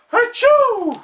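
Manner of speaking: high, loud sneeze; giant sneeze. A narrator's reading. SpongeBob sneezing at the Krusty Krab door, loud. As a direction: let out a huge sneeze. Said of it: "sneezed a giant sneeze".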